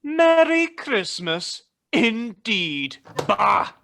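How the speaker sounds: high, drawn-out mocking echo of a greeting; scornful mockery. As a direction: parrot it slowly with contempt. Scrooge scorns clerk's Christmas greeting.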